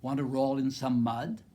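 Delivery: loud, playful question rising at the end; loud pig's invitation. A narrator's reading. Pig to the busy spider, calling out loudly. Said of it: "grunted the pig".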